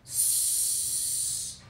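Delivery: long unvoiced hiss on "S"; a soft, drawn-out hiss for "S". Shown as a demonstration. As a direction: let the air out in a long "S". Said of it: "todos en casita".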